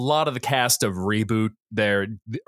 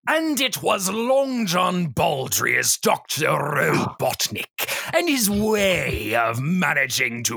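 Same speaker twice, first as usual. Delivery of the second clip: slow savoury villain voice; Robotnik voice; slower than usual. Acted. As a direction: draw out every word like a cartoon villain. Performs Robotnik-style narration voice.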